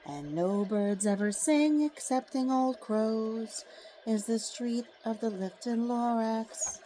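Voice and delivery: slow, hushed storybook reading; story narration. A narrator's reading. Narrator opening a picture book for listeners, slow and hushed. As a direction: draw the listener into the tale gently. Reads The Lorax aloud.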